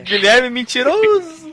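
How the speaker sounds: high, loud laughing tease; laughing taunt. Real, not acted. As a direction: call him a liar, laughing. Teasing accusation while laughing.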